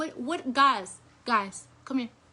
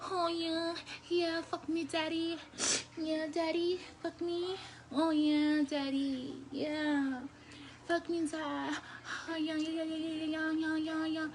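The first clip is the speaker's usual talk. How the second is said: higher, softer hammed-up moaning; 4 semitones higher, 5 dB quieter than usual. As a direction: overdo a fake moan to tease. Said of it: "She knows my fake moan".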